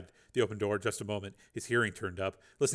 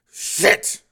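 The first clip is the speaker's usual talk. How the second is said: forced out through clenched teeth, higher and louder; a sharp curse before dropping off the roof; through gritted teeth; 10 semitones higher, 12 dB louder than usual. Acted. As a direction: curse as you brace to jump. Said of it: "he said through gritted teeth".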